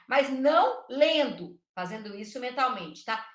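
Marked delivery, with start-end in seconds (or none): none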